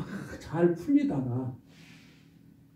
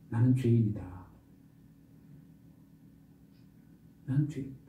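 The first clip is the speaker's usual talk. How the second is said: quiet confession; 5 semitones lower, 6 dB quieter than usual. Acted. Confession voiced inwardly.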